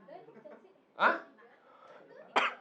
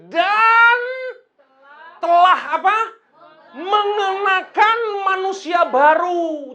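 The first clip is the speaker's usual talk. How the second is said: "Dan" shouted high and held long; forceful emphasis; 11 semitones higher, 16 dB louder, slower than usual. Shown as a demonstration. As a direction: hammer out "Mengenakan manusia baru" loud, high and stretched. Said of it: "kurang ditekan".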